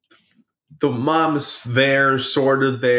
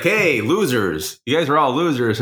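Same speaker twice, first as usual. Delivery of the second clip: ordinary delivery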